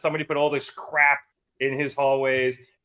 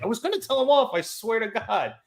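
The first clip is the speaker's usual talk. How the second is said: sheepish excuse; 8 semitones higher than usual. Retold or imitated. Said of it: "Max is like".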